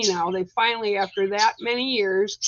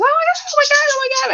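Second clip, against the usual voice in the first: high, loud mock shriek of panic; retold panicked screaming; 7 dB louder, much faster than usual. Mimicking her daughter running from the skunk, in a high panicked shriek. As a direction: shriek it like a teen fleeing in terror. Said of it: "screaming, yelling".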